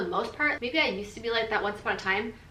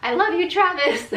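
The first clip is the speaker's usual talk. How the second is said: gushing shout-out; 8 semitones higher, 7 dB louder than usual. Real, not acted. Affectionate shout-out to husband.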